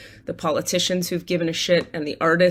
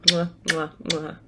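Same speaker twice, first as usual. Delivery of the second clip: smacking air kisses; blown kisses; 2 semitones lower, slower than usual. Real, not acted. Blowing kisses to her stepsister.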